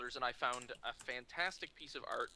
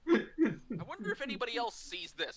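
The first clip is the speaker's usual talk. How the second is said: pitched-up teasing through laughter; amused, high retold tease; mock wondering; 8 semitones higher than usual. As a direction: wonder aloud while starting to crack up. Actor teases, teller laughs.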